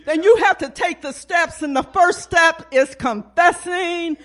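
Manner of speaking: impassioned altar call to the congregation; fervent preaching. Acted. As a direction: preach with force, pausing between appeals.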